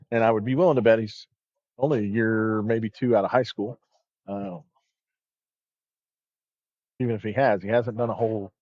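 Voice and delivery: steady bowling broadcast commentary; sports commentary. Acted. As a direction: call the match like a TV commentator.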